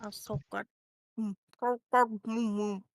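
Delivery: chewing and mumbling; mouth full. Pretend. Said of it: "you best not talk with your mouth full".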